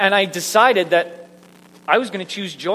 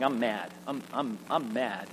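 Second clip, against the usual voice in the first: low, softened mock grumpiness; unconvincing anger; 6 semitones lower, 12 dB quieter than usual. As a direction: try to be mad, half-hearted and quiet. Tries and fails to sound mad.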